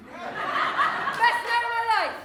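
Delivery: loud, high, slow triumphant cry; ecstatic. Acted. Performer gushing about the wild night, ecstatic triumphant cry. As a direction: shout out pure joy.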